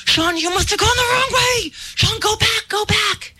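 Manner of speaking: panicked high-pitched cry down the phone; urgent acted yelp, voice way up; frantic urging. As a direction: re-enact desperately redirecting a lost brother. Retold panicked orders to brother.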